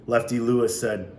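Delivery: ordinary delivery, nothing marked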